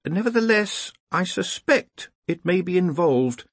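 high-pitched, mildly resigned character voice; sighing. A narrator's reading. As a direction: reluctantly admit it, drawn out at the end. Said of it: "sighed Ponder".

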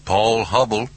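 tinny Southern voice on speakerphone; confident Southern accent. A narrator's reading. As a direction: answer the phone with Southern confidence. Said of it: "A southern accent, a confident manner".

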